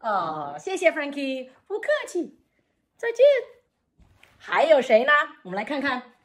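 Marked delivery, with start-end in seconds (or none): 1.7-3.3 puppet voice. Acted. Puppet Frankie replies.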